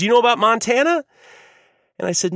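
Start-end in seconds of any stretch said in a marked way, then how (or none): 0.0-1.0 high, loud leading question; camp counselor. Retold or imitated. Counselor quizzes a new camper, high and loud. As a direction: ask it big, pitch climbing at the end. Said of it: "And he goes".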